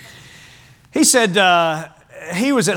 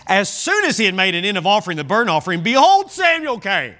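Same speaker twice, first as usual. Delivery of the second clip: pulpit reading rising high at the reveal; dramatic scripture reading; 6 semitones higher than usual. Acted. Preacher revealing Samuel's arrival to the church, rising dramatic reading. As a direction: build to a high-pitched surprise arrival.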